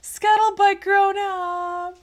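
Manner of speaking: very high, loud, slow call; excited exclamation. Retold or imitated. Streamer crying out a viewer's suggestion, excited exclamation.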